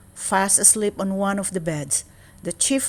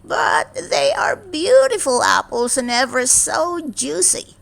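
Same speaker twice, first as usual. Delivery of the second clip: high, louder coaxing crone voice; velvety witch; 9 semitones higher, 4 dB louder than usual. A narrator's reading. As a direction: tempt her with sweet witchy charm. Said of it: "said the velvety voice".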